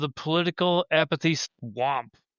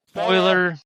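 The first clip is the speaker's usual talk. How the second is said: excited spoiler call; 7 semitones higher, 8 dB louder, slower than usual. Acted. Teasing announcement of next topic.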